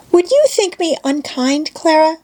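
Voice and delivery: put-on high, hesitant little girl asking; a girl unsure whether to ask. A narrator's reading. Heidi asking Clara if she may leave, high and hesitant. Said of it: "rather hesitatingly".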